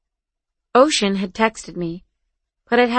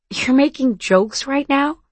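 slow, higher-pitched incredulous question; amused disbelief; 6 semitones higher, slower than usual. A narrator's reading. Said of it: "Ocean laughed".

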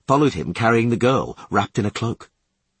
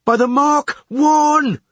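high, slow, loud sounding call; leadsman's yell; 7 dB louder, slower than usual. A narrator's reading. Leadsman calling the depth to skipper, slow high yell. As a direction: yell it drawn out across the boat. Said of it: "yelled the leadsman".

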